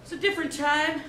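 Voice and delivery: solemn mock-documentary narrator; documentary narration.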